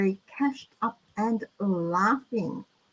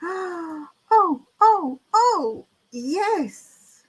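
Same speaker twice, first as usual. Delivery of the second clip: delighted gasp; 7 semitones higher, much slower than usual. Real, not acted.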